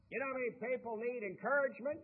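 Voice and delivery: put-on booming Georgia accent, rising at the end. Speaker quoting the old Chick-fil-A founder, in a loud Georgia accent. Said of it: "that Georgia accent".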